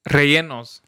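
ordinary talk

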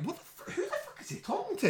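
high, whispered laughing disbelief; breathless disbelief. Retold or imitated. Recalling his shock at Mark, whispered laughing. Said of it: "I'm like".

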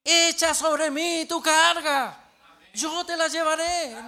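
very loud, high, slow proclamation; thundering scripture quote; booming declamation. Acted. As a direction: belt out the verse, drawing out each word. Declaims the verse at the pastor.

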